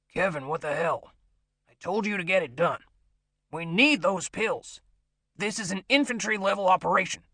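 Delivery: irritated. Acted. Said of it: "He sounded irritated".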